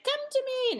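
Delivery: put-on very high, sing-song witch luring a child; hammed-up witch lure; luring witch call. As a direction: coax him down like a witch. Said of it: "he's like".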